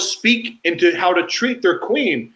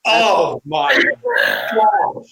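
slow, teary oh my gosh; real emotion catching her voice; choked up; 4 semitones higher, much slower than usual. Real, not acted. Moved by the story.

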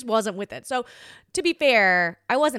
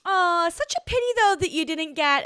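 mock-sweet condescending pity; sugary fake sympathy, pitched up; patronizing; 9 semitones higher, 5 dB louder than usual. To her ex, sweetly patronizing fake pity. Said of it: "I was so patronizing to him".

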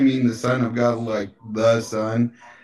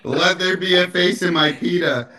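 mock proclamation through laughter; laughing, higher pitched; 6 semitones higher than usual. Real, not acted.

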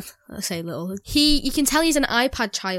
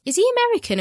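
higher, puzzled imitation of a question; 11 semitones higher, much faster than usual. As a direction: retell it as a baffled, raised question. Child's confused question retold.

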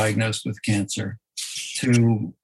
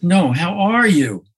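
"are" pushed louder and higher in "No, how are you?"; insistent, stress on "are"; 6 semitones higher, 5 dB louder than usual. Retold or imitated. Man refuses "I'm fine" and presses, stress on "are". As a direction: press him with a firmer "are". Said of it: "putting different emphasis on the different words".

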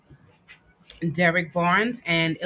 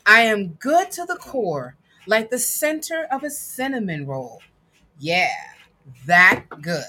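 put-on reading to children; 4 semitones higher than usual. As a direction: read it proudly to a child. Reads picture book aloud.

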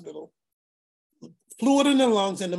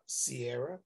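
much softer, lower calming call of her name; calm, gentle; 7 semitones lower, 12 dB quieter than usual. Retold or imitated. Uncle gently calming his furious niece on the phone. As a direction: settle her down with just her name.

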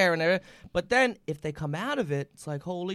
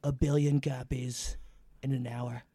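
Trump impression; 5 semitones lower than usual. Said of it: "so that's a little".

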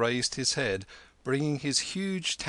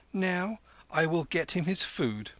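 spoken loudly; 7 semitones higher than usual. Acted. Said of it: "spoken loudly, ended with a sigh".

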